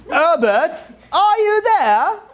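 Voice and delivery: high, drawn-out mock English hail; piping English voice, stretched long; hammed-up English caller. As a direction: call out like a cartoon Englishman searching in the dark. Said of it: "they light it up, and they'll be like".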